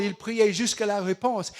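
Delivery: ordinary talk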